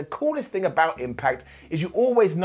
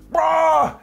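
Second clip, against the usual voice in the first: loud high yell; wrestler's entrance yell; 11 semitones higher, 5 dB louder than usual. Retold or imitated. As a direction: belt it out like entering the ring. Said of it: "you walk out and you go".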